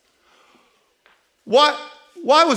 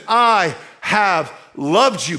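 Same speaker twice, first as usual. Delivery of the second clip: I stressed, words drawn out slowly; slow emphatic; 2 semitones lower, much slower than usual. Acted. Preacher repeating God's promise to the church, slow and emphatic. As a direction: lean on each word of the promise.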